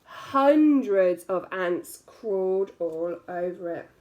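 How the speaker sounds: ordinary delivery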